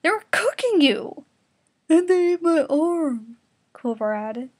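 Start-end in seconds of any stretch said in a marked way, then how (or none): 0.0-1.2 high, loud shouted rebuke in a put-on voice; horrified shout. Horrified outburst at Keiko. 1.9-3.4 high, loud, slow put-on whine; whiny complaint. Clover added, complaining loudly.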